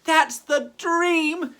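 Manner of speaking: put-on a ghost girl wishing to be seen; high, drawn-out wistful sigh of a girl; dreamy ghost girl. Elizabeth's longing thought.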